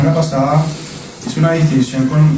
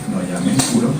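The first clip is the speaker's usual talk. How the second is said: a low, quiet voice of God; 10 semitones lower, 6 dB quieter than usual. Acted. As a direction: lower and softer, grave divine rebuke. God answering Pedro again.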